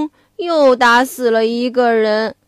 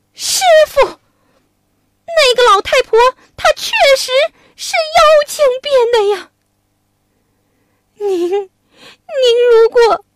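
much higher, fast and urgent; a monkey begging his master to believe him; pleading Monkey King; 8 semitones higher, 3 dB louder than usual. Acted. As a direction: Wukong protesting to Tang Seng. Voices Wukong pleading.

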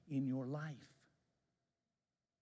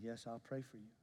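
soft promise; 4 semitones lower, 10 dB quieter than usual. Retold or imitated. Pastor softly agrees to pray.

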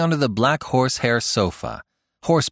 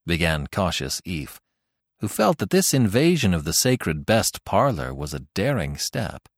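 usual speaking voice

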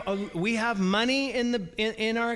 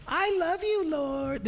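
high, whining mock-innocent voice; mocking whiny priests; 9 semitones higher, 3 dB quieter, slower than usual. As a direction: play the offended priest in a high whine. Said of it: "this is what the priests were saying".

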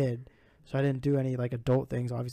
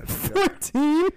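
surprised laugh; 8 dB louder than usual. Real, not acted. Surprised at age claim.

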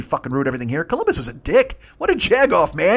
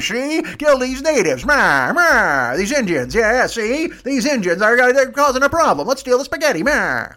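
put-on higher, louder old-movie gangster snarl with "see?" tics; Jimmy Cagney gangster; 7 semitones higher, 4 dB louder, slower than usual. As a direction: ham up a 1930s tough-guy mobster giving orders. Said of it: "why he's Jimmy Cagney or a vampire".

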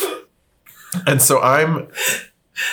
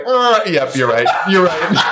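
awkward, laughing yep; sheepish laughing agreement; 6 semitones higher than usual. Retold or imitated. Telling a friend, laughing sheepishly at the date. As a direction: agree quickly through embarrassed laughter.

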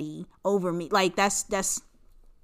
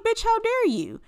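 much higher, indignant mock squeal; mock outrage; 13 semitones higher than usual. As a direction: squeal it like an offended diva. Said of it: "'cause it's like".

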